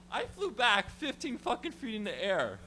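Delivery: ordinary talk, nothing marked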